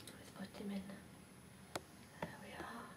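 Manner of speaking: very soft, whispery murmur; hushed aside. Real, not acted. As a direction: say it under your breath while busy. Aside while placing the toy.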